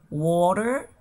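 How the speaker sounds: "Water" with a tapped middle and final r, rising; variant pronunciation. Shown as a demonstration. Teacher listing ways to say water, "Water" with tapped t and final r. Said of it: "se pueden decir de diferentes maneras".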